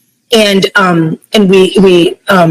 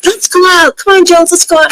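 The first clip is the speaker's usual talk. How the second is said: high, coaxing voice of the friends; friends urging; 11 semitones higher than usual. Retold or imitated. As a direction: pitch way up and coax her to go out. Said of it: "And they're like".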